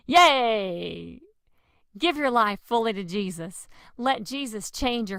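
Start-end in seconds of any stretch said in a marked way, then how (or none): 0.1-1.2 loud playful yay; happy shout; cheer. Pretend. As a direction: cheer for the kids.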